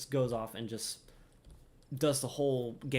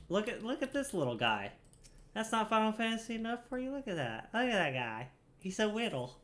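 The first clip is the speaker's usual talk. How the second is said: high, gushing coo over the little guy; fond high coo at a tiny character; cooing at cute; 10 semitones higher than usual. Real, not acted. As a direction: gush like seeing a tiny pet.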